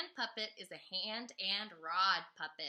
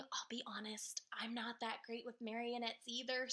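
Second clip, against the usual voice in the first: soft whispered aside; whispering; 2 semitones higher, 5 dB quieter than usual. Real, not acted. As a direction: whisper it sheepishly. Whispered confession.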